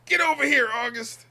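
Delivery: hollering after August; mock angry yell. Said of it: "imagining a chase".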